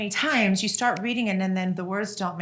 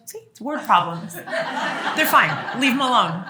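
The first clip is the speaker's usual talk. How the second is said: louder, laughing defense; laughing dad quote; 4 semitones higher, 5 dB louder, slower than usual. Retold or imitated. Dad telling mom to leave the kids alone, laughing. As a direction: wave mom off through laughter.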